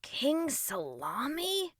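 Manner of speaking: slow, high mocking question. A narrator's reading. Boy makes fun of king's name, mocking. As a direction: draw out the silly name teasingly. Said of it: "Russ mocked".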